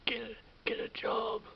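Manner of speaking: loud mock yell of a passing driver; imitating a heckler's shout; retold yelling. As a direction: bark it like a jeering motorist out the window. Passer-by yelling from car.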